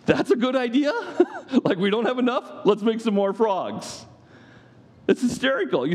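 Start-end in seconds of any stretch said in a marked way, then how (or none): 0.0-0.9 high, laughing incredulity; sarcastic disbelief. Acted. Preacher mocking the magicians' plan, high and laughing. 1.0-1.6 high amused laugh. Real, not acted. Preacher laughing at the magicians' folly, a high laugh. 1.6-4.0 high, laughing incredulity; sarcastic disbelief. Acted. Preacher mocking the magicians' plan, high and laughing.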